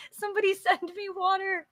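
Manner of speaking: high, soft, fast giggly plea; laughing. Pretend. Repeat softer, laughing.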